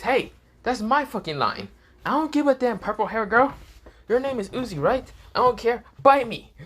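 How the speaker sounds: high-pitched, feisty snapping back; heated character voice, rising in volume; indignant retort. Acted. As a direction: fire it off indignantly, pitched well up. Acted angry retort in told quarrel.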